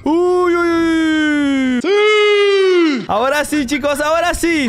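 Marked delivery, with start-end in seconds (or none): 0.1-3.1 rising drawn-out whoop into a shout; grito de celebración. Real, not acted. Celebra un momento del combate. (grito largo y agudo de celebración)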